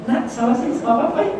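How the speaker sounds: put-on high, sweet coaxing to a baby; a light imitation of a doting dad; father coaxes his child to come, in a sweet high voice.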